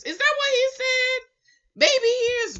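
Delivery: high, loud incredulous question; can't believe he said that; shocked disbelief. Real, not acted. Reacting to a man saying baby hairs, high and shocked.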